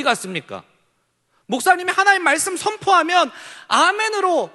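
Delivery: high, loud impassioned sermon cry; fiery preaching. Real, not acted. Pastor challenging the youth's lost passion, preaching loud. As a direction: rouse the congregation, pleading.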